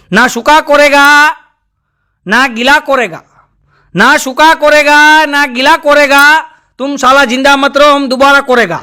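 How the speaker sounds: put-on slow, garbled Nepali-accented recital of the couplet, સુકા and કોરેગા stretched. Nepali watchman reciting his garbled shayari, slow and proud. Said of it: "એને કીધું".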